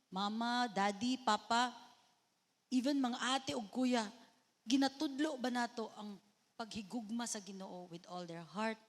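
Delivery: usual speaking voice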